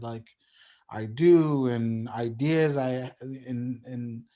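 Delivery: ordinary talk, nothing marked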